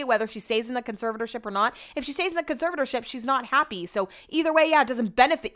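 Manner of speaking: usual speaking voice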